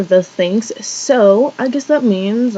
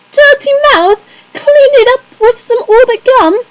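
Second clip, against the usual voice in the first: much higher, mocking a fake British ad accent; 12 semitones higher, 3 dB louder than usual. Mocking the new gum ad girl, in a high fake British accent. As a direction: parody the gum ad girl's phony British accent. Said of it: "her accent didn't even sound real".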